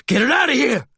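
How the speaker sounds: high-pitched, screamed order; frantic scream. A narrator's reading. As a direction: scream to have it removed at once. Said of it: "he screamed".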